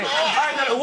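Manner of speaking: ordinary talk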